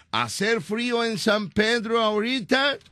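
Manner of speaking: put-on high, slow gringo-accented question; mock English. Host retrying the question on Eva, mock English accent. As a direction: draw the Spanish out as fake American English. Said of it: "Vamos otra vez".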